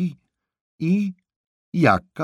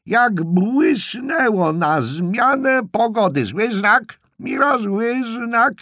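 high, loud screech of dire omens; shrieking old aunt; 7 dB louder than usual. Acted. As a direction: holler the forecast at a deaf man. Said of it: "odwrzasnęła ukontentowana ciotka Ewi".

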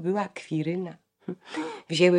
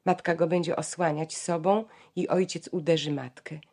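ordinary talk, nothing marked

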